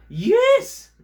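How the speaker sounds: very high, loud puppy squeal; delighted puppy. Acted. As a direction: burst out like an overjoyed little dog. Puppy puppet answers host.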